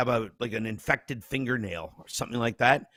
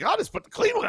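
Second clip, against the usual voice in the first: high, breezy self quote; high lazy self-talk; 3 dB louder, faster than usual. Retold or imitated. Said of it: "So I'm like".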